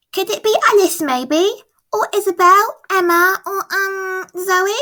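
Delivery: put-on high, eager small girl asking her mum; little girl voice. Francie asks Mum about her sister, high child voice. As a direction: lift into a bright child's voice.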